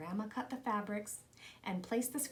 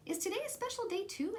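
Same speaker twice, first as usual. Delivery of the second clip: put-on much higher, eager little boy asking; child's voice; 10 semitones higher, faster than usual. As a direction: voice a small boy hoping for a treat. Little boy Jim asks Papa.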